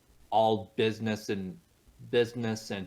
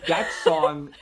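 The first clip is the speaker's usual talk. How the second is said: loud, repeated protest; mock-angry protest; 5 semitones higher, 7 dB louder than usual. Real, not acted. Guest teased about singing in French accent, mock-angry shutting down. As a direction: shout them down in playful exasperation.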